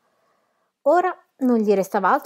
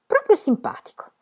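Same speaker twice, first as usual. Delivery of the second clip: much higher, lingering proprio simpatico; dreamy echo. A narrator's reading. Narrator voicing Janie's crush on him, much higher. Echoed as her swooning thought.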